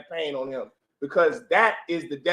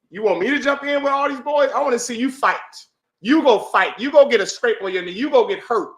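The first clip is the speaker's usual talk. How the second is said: higher, pushed voice of a father challenging his son; 6 semitones higher, 5 dB louder than usual. Acted. Father sending his son out to face the boys, challenging. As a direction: dare the boy to stand up for himself. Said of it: "A good parent sees his son".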